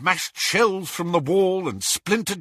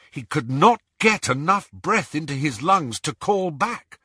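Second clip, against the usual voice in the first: usual speaking voice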